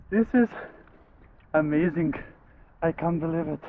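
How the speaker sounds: breathless thrilled disbelief; buzzing after the icy plunge; excited. Real, not acted. Just finished the ice dip.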